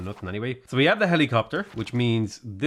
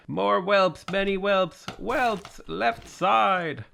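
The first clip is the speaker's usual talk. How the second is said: imitated raid scream; 8 semitones higher, much slower than usual. Gamer imitating a man screaming at his raid, mock scream. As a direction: mimic a worked-up raid leader calling orders. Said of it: "screaming at his raid".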